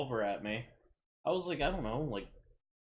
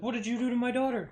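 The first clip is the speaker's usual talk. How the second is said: higher, faster accusing repeat; pressing the question again, louder and higher; retold angry dad; 10 semitones higher, 4 dB louder, faster than usual. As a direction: push the demand harder the second time.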